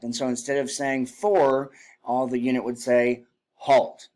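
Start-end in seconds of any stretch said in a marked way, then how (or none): none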